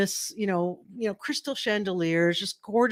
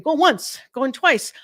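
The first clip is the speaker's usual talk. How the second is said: high, loud going once going twice; auctioneer countdown; 6 semitones higher, 5 dB louder, much slower than usual. Retold or imitated. Imagined auctioneer closing the bid, high loud countdown. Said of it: "I'm thinking".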